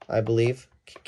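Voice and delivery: usual speaking voice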